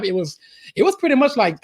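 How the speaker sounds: normal talking voice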